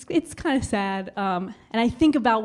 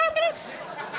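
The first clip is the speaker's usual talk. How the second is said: very high, softer squealed threat; enraged monkey; 7 dB quieter, slower than usual. Retold or imitated. Monkey sister threatening the couple, high-pitched mock fury.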